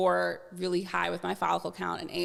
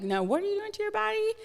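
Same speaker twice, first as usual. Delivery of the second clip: high, fast scolding voice; mock the doctor's shocked scolding; disapproving doctor; 14 semitones higher, faster than usual. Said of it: "So it wasn't like".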